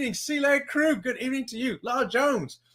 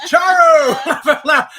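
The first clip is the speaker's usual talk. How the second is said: laughing; 7 semitones higher, 10 dB louder than usual. Pretend. Echoing the [name] bit, laughing.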